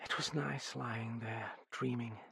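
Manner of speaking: soft, dreamy murmur; reminiscent. A narrator's reading. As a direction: drift softly back into the memory.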